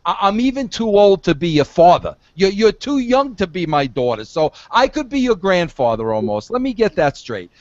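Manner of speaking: ordinary talk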